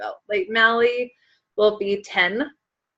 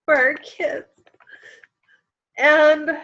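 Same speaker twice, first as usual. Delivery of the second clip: emotional; 4 semitones higher, slower than usual. Real, not acted. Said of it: "I don't know why I'm so emotional".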